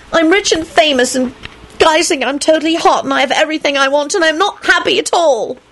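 high, self-pitying lament; miserable Rosie voice. A narrator's reading. Rosie lamenting to Lupin, high self-pitying voice. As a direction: whine out her unhappiness.

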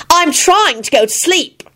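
exasperated high-pitched whine; cross. A narrator's reading. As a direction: complain crossly in a high, slowed voice. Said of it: "she said crossly".

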